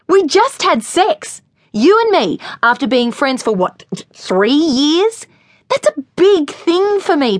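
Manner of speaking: furious tirade. Acted. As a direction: tear into him, voice climbing high. Lily's furious tirade at Pete.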